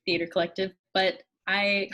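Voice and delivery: ordinary talk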